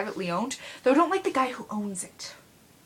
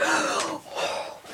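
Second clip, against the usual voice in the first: mock gasp. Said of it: "I literally just went".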